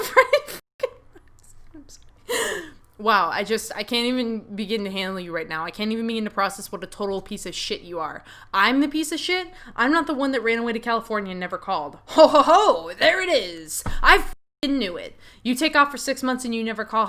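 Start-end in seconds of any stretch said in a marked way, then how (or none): 0.0-2.6 much higher, cracking with laughter; can't keep it together, laughing; real laughing fit. Real, not acted. Narrator cracks up at scene. 12.1-15.2 mocking triumph. Carmen triumphant in argument.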